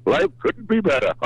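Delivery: high, happy line ending in laughter. Real, not acted. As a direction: sound delighted with life, then laugh. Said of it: "you sound happy".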